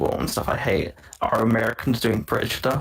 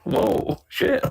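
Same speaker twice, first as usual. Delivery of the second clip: high jolt of shock, rising to a yelp on shit; stunned surprise; 11 semitones higher than usual. Retold or imitated. Retelling first watching Deathly Hallows, high mock shock. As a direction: caught completely off guard. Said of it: "being like".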